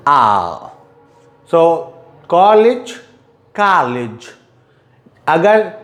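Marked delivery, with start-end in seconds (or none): 2.3-2.9 कॉलेज with a British short o, raised; teacher demos British कॉलेज with a rounded o; British college. Shown as a demonstration. रोंदेड ओ वाला British उच्चारण. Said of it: "British अ sound". 3.6-4.3 कॉलेज with an American open a, dropped low; teacher demos American कॉलेज as kaa-lij; American college. Shown as a demonstration. अमेरिकी उच्चारण, खुला आ. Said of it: "and there is आ".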